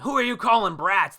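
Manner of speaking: high, loud indignant retort; hammed-up angry bratty comeback; pissed off. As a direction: shout back, affronted. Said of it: "They're both pissed".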